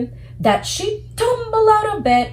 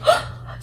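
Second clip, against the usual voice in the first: scared gasp. Shown as a demonstration. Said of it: "show me how she's doing with her face".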